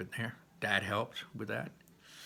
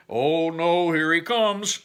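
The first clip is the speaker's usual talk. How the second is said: playful dread; 7 semitones higher, 10 dB louder, slower than usual. A narrator's reading. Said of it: "said Barley".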